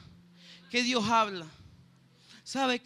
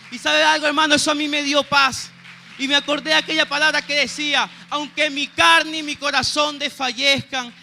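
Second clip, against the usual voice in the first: full-force preaching; 9 dB louder than usual. Acted. Preacher proclaiming God's promise to the church, loud and fervent. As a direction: proclaim it to the congregation with fire.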